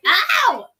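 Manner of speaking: sudden high cry of pain; sharp yelp of pain. Real, not acted. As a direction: yelp as your hair is pulled. Baby pulls her hair.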